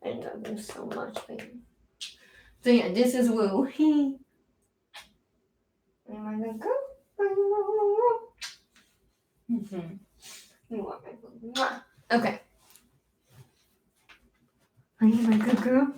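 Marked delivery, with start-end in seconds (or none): 0.0-1.5 sweet nothings. Real, not acted. Cooing to her dog. 6.1-15.9 sweet nothings. Real, not acted. Cooing to her dog.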